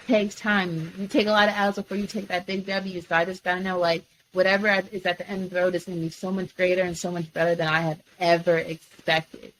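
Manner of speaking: usual speaking voice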